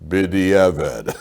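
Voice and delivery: a lightly voiced verdict from heaven, drawn out; heavenly voice. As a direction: answer like an unseen voice from above, slowly. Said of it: "he hears the voice comes out and says".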